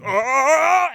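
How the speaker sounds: high imitated cry; mock alien scream. Imitating the alien as it starts shaking apart, mock scream.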